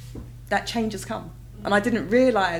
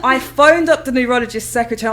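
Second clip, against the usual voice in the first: ordinary talk, nothing marked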